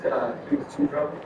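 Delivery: ordinary talk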